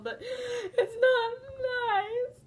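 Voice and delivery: hammed-up high crying whine; fake-cry the complaint, drawn out; mock sobbing. Complaining about the tiger joke, mock crying.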